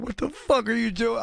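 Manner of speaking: high-pitched retold crying outburst; imitated tearful upset. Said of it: "and she's crying".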